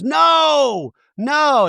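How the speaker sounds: high, loud mock no; hammed-up alarmed yell of no; mock shout. Mary stopping the fight, mock shouting no. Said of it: "And Mary goes".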